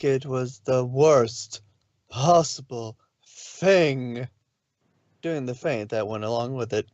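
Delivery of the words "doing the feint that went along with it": ordinary delivery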